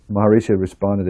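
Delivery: ordinary delivery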